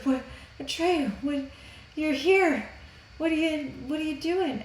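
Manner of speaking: high, hesitant little Empress voice; worried Empress. Retold or imitated. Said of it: "she's got a very worried look".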